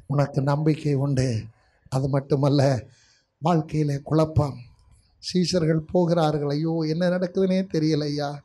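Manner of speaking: soft, reassuring sermon voice; hushed preaching. Real, not acted. Preacher comforting the confused and fearful, hushed preaching. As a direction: bring it right down and reassure them.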